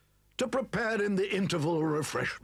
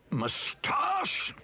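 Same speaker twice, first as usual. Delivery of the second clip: shocked; 9 semitones higher than usual. Acted. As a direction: burst out in disbelief. Hearing Toad swallowed moustache.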